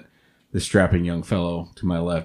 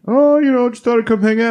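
imitating Mike; 6 dB louder than usual. Quoting Mike's casual excuse.

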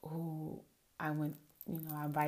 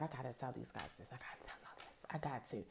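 softer, fast eager whisper; whispered excitement; 4 semitones lower, 8 dB quieter, faster than usual. Retold or imitated. Host recalling urge to tell listeners, excited whisper. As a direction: hiss it like a secret you can't hold. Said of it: "I was like".

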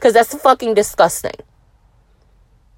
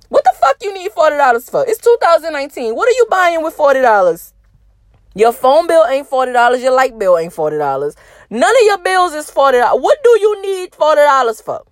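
exasperated rant; 6 semitones higher than usual. Real, not acted. Ranting to listeners about forty dollars, high and exasperated. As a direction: demand answers, worked up and high.

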